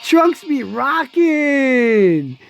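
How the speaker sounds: slow laid-back surfer dude voice. Pretend. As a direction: drag it out like a surfer. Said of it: "I just sound like a surfer".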